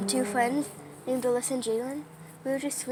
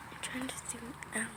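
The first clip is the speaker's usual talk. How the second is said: whispering; 14 dB quieter than usual. Real, not acted. Whispering during storm.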